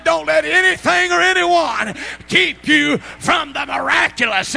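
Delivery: slow, high pulpit shout; very loud, drawn-out revival exhortation; full-force preaching. Real, not acted. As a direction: shout praise to rouse the congregation.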